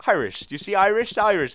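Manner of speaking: American attempt slipping into an Irish accent; accidental Irish accent. Shown as a demonstration. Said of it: "The Irish accent's right there as well".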